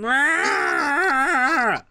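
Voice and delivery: high, strangled monster cry; creature wail. Pretend. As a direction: let out a helpless creature yell. Deformed creature crying out.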